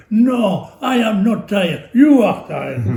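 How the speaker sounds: put-on an old friend denying he is tired to the wife, loud and high; indignant retort. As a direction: fire it back, playfully indignant.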